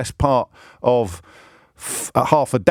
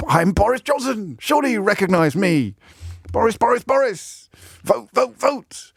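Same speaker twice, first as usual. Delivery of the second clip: higher, hammed-up jolly Boris Johnson voice; mock Boris chanting his own name and vote, higher; Boris Johnson impression; 8 semitones higher than usual. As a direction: play the chummy schoolboy prankster, pitched up. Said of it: "my impression of Boris Johnson".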